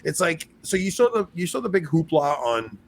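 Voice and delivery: normal talking voice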